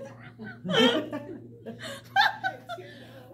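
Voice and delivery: long high-pitched laughing fit. Real, not acted. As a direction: let the laugh run on. Laughing at her own story.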